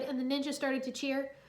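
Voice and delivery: plain reading voice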